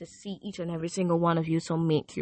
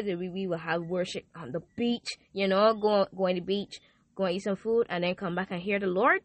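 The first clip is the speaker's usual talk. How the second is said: ordinary talk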